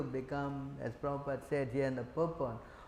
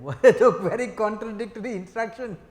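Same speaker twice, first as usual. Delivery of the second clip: laughing through the words, louder; 4 semitones higher, 8 dB louder than usual. Real, not acted. Teacher laughing to his class over contradictory instructions, laughing. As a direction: chuckle at the contradiction.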